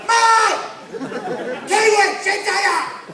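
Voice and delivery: high, full-volume heckling yell. Retold or imitated. Aussie bloke yells sheep jokes at comic, high loud heckle. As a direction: bellow it like a bloke who won't be ignored. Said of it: "they just get louder".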